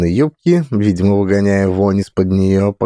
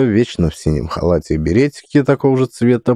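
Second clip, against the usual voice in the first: usual speaking voice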